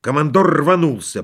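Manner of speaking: plain reading voice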